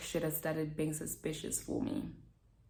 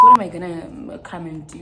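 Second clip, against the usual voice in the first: retold outraged yell; 17 dB louder, much faster than usual. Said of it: "I'm like".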